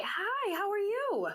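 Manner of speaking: ordinary talk, nothing marked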